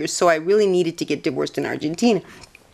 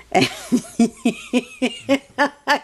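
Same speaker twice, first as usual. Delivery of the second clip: high laugh; 9 semitones higher than usual. Real, not acted. Laughing at his joke.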